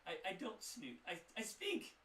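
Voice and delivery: high, loud, huffy shopkeeper voice; indignant shopkeeper. Acted. Shopkeeper mishears the warlock and huffs back, high and loud. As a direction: take offence at the misheard word.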